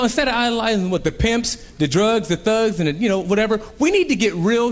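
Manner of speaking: ordinary talk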